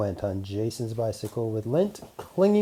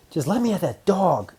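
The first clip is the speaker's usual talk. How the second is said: shouting; 7 semitones higher, 5 dB louder than usual. Acted. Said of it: "Oops shouted".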